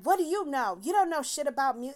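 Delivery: hammed-up offended musician; retold angry retort. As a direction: throw his irritation back, half-acted. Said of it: "pissed off".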